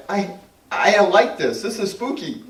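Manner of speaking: high-pitched retold nervousness; acted unease. Acts out own unease while channeling.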